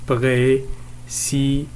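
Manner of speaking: "Précipitation" broken into pré, ci, pi, ta, tion; teacher's slow syllable split of "Précipitation"; five separate syllables. Shown as a demonstration. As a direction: count out "Précipitation" slowly with a gap after each syllable. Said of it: "cinq syllabes".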